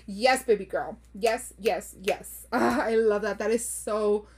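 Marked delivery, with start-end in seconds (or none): none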